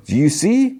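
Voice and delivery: high, eager retold excitement; imitating an excited Solo. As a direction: ask it bursting with eagerness. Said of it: "but then he gets excited".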